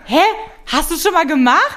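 high, loud, incredulous question; surprised question. Real, not acted. Teasing friend presses the question. (laut und hoch, ungläubig nachgehakt)

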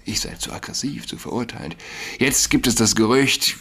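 ordinary talk

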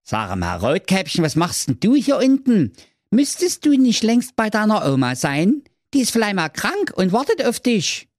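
high cheeky knight; high Manni voice. Acted. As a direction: ask it like a high little knight.

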